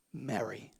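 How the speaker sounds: soft, low, tender naming; Jesus softly calling Mary. Acted. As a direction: say her name gently, like a caress. Jesus calls her name.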